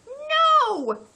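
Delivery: high, loud frightened cry; boy crying out at the bear; panicked shout. Acted. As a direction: yell it as the bear paws at you. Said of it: "Louis shouted".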